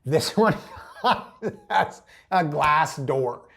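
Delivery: loud, real laughter breaking into words; helpless amusement, words drawn out; laughing while talking. Real, not acted. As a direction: crack up mid-sentence. Cracks up at the glass door.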